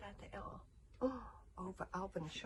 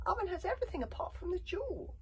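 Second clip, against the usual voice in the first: put-on high, loud boy's protest; 8 dB louder than usual. A narrator's reading. Son protesting that he lacks the things, high protest. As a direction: object in a raised, youthful pitch. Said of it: "protested Hiccup".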